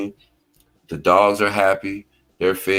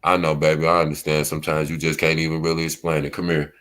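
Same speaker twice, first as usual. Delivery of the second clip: tender comfort; 6 semitones lower, faster than usual. Retold or imitated. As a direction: gently soothe a crying wife. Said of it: "Or do I say".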